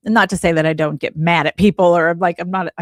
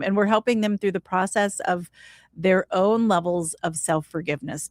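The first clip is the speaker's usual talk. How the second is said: usual speaking voice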